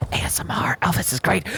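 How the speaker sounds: breathy ASMR whisper. Pretend.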